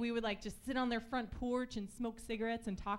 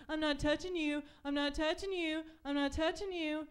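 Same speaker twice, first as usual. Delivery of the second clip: taunting sibling; 4 semitones higher than usual. Retold or imitated.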